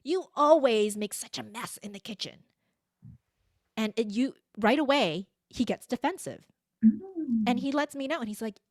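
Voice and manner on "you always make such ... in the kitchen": slow, louder blaming "You always"; nagging a partner with a loud, slow accusing tone. Retold or imitated. Wife scolding her partner about the kitchen, slow, loud and accusing. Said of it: "in that tone of voice".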